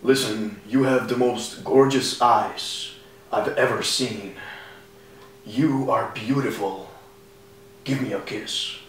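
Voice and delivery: low, soft mock seduction; pickup line. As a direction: play a hushed would-be charmer. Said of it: "you can't just be like".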